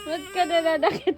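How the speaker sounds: normal talking voice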